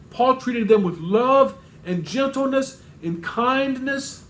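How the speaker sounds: usual speaking voice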